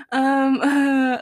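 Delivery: imitated short, panicky breaths; shaky-breathed stammer, played back; near hyperventilating. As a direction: act out catching your breath between filler words. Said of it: "not hyperventilating, but I'm like".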